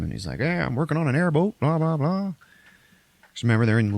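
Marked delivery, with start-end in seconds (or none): 0.4-2.3 put-on lightly done southern accent, higher. [name] chatting about downtime, light southern accent. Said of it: "[name] Russell also has a southern accent".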